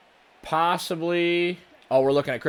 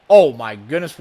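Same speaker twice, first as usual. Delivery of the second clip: surprised; 2 semitones lower, 8 dB louder than usual. Real, not acted. Opponent breaks a big play.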